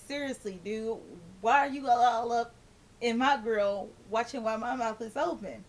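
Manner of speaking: usual speaking voice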